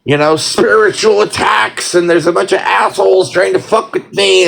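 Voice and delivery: ordinary talk, nothing marked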